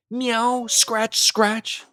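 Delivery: high meow then scratch scratch; a cat pawing at the window, voiced high; cat scuffling. A narrator's reading. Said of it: "scuffled Simpkin along the window sill".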